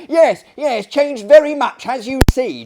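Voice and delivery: loud, high crowd chant; chorus of lunatics. Acted. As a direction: chime in all together, loudly. Whole company chimes in together.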